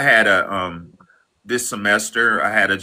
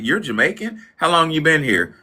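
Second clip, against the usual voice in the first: retold surprise; 8 semitones higher than usual.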